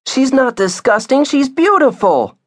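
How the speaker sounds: put-on high-pitched indignant child's protest. Via defending the dog to the adults, high and indignant. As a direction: stand up for her in a high child voice.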